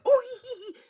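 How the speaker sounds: high mock giggle from the kids; high imitated children's giggle; kids giggling. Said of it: "what's that giggling about".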